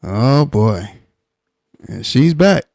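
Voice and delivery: normal talking voice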